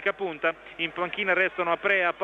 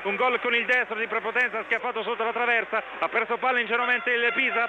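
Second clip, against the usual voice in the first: excited goal commentary; 6 semitones higher than usual. Acted. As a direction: shout the equaliser with joy. Goal call, equaliser excitement. (radiocronaca del gol concitata)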